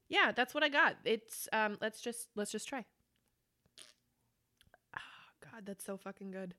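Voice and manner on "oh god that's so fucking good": hushed, awed murmur of pleasure; low and soft, relishing the taste; savouring. Real, not acted. Savouring a sip of beer.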